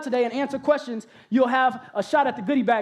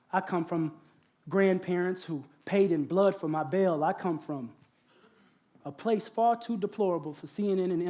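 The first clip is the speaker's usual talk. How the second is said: lower, softer, measured slam-poetry recital; spoken word poem; 7 semitones lower, 7 dB quieter than usual. Acted.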